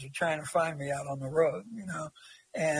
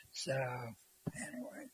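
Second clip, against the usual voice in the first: whispered trail-off; 7 semitones lower, 15 dB quieter, much slower than usual. Real, not acted. Trailing off after his story.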